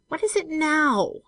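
high, slow, fearful question. A narrator's reading. Benson as she springs from the well, fearful. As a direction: draw it out in rising alarm. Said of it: "he asked in a fearful voice".

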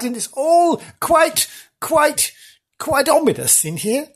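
mock-creepy build-up; drawn-out spooky. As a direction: stretch out quite, quite, quite. Creeping through haunted theatre.

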